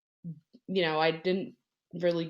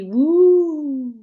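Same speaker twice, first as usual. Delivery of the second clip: high, loud ghostly woo; excited woo; 11 semitones higher, 12 dB louder than usual. Pretend. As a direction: howl it like a spooky ghost. Spooky play on Wendigo name.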